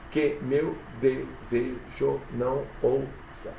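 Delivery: "Que meu desejo não ousa" slowly split into separate syllables. Shown as a demonstration. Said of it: "Conta até a sílaba tônica". ("Que meu desejo não ousa" devagar, sílaba por sílaba)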